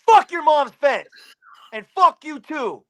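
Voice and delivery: loud, high, drawn-out comeback; re-enacted defiant shout; angry retort. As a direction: throw it back at him loudly. Retelling his angry retort.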